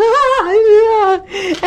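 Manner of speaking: high, loud shrieking mock laugh; hysterical laugh imitation. Nervous actors backstage, a high hysterical mock laugh. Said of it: "They get hysterical".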